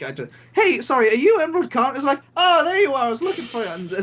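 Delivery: normal talking voice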